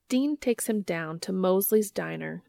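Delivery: plain reading voice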